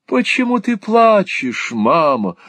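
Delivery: put-on high, slow little child's voice. A narrator's reading. Lenville acting the child to the weeping mother, high child voice. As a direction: plead like a small child. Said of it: "говорит ребёнок".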